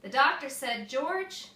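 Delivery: plain reading voice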